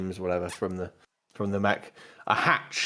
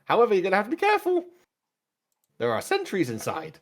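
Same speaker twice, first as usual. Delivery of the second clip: high droid voice reciting its designation; formal droid with pitch jumping high; 8 semitones higher than usual. Acted. Droid answers its new master, high droid voice. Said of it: "best describe as a pit droid".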